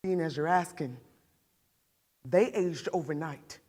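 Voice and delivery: put-on remember the children's lost innocence, quietly pained; understated grief of a mother; grieving mother.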